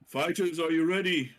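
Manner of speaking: rally both fighters before the bell; ring announcer's call. Acted. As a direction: call out like a fight-night host. [name] calls fighters to start.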